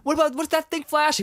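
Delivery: very high mock-anxious kid voice; hammed-up high-pitched query; Luke. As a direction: pipe up high with a worried question. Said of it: "Luke's like".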